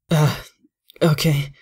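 nervous hesitant stammer; stuttering. Acted. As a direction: stammer it out uneasily. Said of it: "I stuttered".

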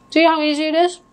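talking while chewing; words round a bite of food; mouth full. Real, not acted. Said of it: "I always talk with my mouth full".

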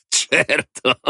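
laughed-out scornful retort, high; laugh, then toss the word out high. A narrator's reading. Man scoffs at the woman, laughing. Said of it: "e poi rise".

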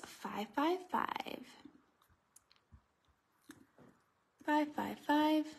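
slow stretched number call; bingo caller. Real, not acted. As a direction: draw out each number. Repeating the drawn number.

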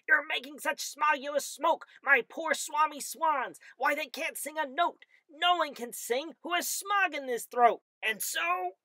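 high creature voice, scolding; Lorax scolding. A narrator's reading. The Lorax scolds the Onceler about smog, high voice. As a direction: complain high and sharp. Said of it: "he cried with a crofthless croak".

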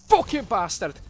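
loud, high exclamation; angry shout. Real, not acted. Streamer crashing while driving, angry shout.

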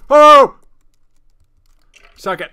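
very loud, high-pitched yelling; screaming. Real, not acted. Gamer yelling at a skeleton with new mic, screaming. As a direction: scream it at the enemy in triumph. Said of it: "I can scream as loud as I want".

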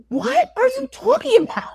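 very high-pitched, baffled question; mock exasperation, voice shooting up; exasperated disbelief. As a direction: play the bewildered groom at wits' end. Exasperated prince in acted retelling.

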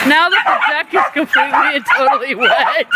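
laughing while calling it out. Real, not acted. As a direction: speak through real laughter at the wet deck. Deck soaked by the hose.

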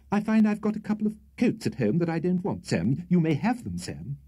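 a hint of an anxious toff currying favour; nervous Mr Winkle. A narrator's reading. Winkle voiced by narrator.

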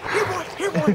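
high-pitched call to coax a dog. Retold or imitated. Storyteller re-enacting calling the neighbor's dog indoors, high-pitched dog call. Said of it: "The dog, I said".

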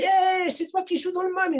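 high, loud, starstruck question; excited fan. Retold or imitated. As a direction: gush at him like a starstruck fan.

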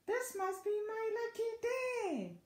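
high, sustained exclamation; fox's mild storybook shout; jubilant shout. A narrator's reading. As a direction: proclaim it high with delight. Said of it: "the fox shouted".

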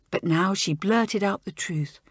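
usual speaking voice

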